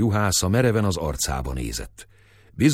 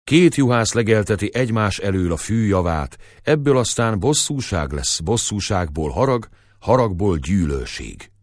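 ordinary delivery

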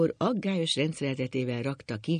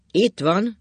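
loud, higher-pitched call; drunken shout; 6 semitones higher, 8 dB louder than usual. A narrator's reading. Drunk husband showing off his money, shouting. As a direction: shout it out triumphantly. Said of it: "kiabált Ákos".

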